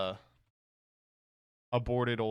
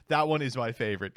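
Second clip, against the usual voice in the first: ordinary talk, nothing marked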